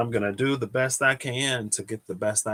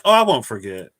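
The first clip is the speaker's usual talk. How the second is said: breezy, louder self-assured brush-off; mock overconfident; 9 dB louder than usual. To viewers, mocking her future self, breezy and sure. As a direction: mimic her own cocky promise. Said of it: "I'm like".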